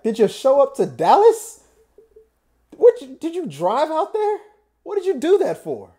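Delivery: high, loud disbelieving questions; mock incredulous friends. Friends questioning the fan's trip to Dallas, incredulous. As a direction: pitch each question up in disbelief.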